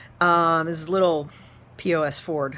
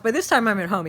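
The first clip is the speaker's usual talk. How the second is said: ordinary talk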